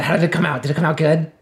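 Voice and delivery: ordinary talk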